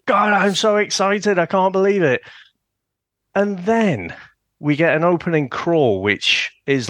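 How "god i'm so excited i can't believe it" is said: high, gushing fan excitement; giddy excitement. Retold or imitated. Fan at the cinema before the movie, high giddy gush. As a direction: bubble over like a kid before the film. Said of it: "I was like".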